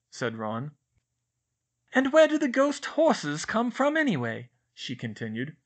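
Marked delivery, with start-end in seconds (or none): 1.9-4.5 put-on higher, far brighter girl's voice, fussy and earnest; Hermione voice. A narrator's reading. Narrator voices Hermione complaining to Ron and Harry, higher girl voice. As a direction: play a know-it-all schoolgirl fretting.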